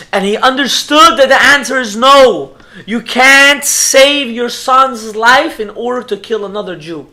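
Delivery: high, loud, weighty storytelling; impassioned preaching. Real, not acted. Preacher reveals the rabbi's painful answer, impassioned preaching. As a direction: drive the verdict home with full voice.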